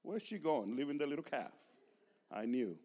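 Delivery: hushed, disapproving aside; gossiping cows. Acted. Other cows watching the mother leave her calf, hushed gossip. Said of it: "the other cows must have been".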